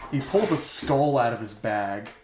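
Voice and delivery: usual speaking voice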